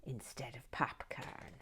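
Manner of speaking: soft breathy aside whispered; a secret snack confession, whispered. Real, not acted. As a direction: confide it in a hushed whisper. Aside while eating snacks.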